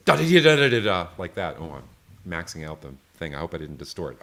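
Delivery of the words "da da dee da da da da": loud, higher, faster sung phrase; strong version. Shown as a demonstration. Teacher demos a strong wrist flick, loud fast sung phrase. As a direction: belt the phrase out like a hard flick. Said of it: "and you do like this".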